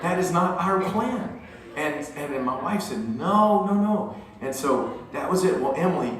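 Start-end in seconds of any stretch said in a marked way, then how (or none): 0.0-1.4 high-pitched, emphatic mock refusal; firm parental refusal. Parents firmly refusing daughter's idea. 3.2-4.1 high, mock-alarmed string of noes; emphatic refusal. Voicing wife's flat refusal.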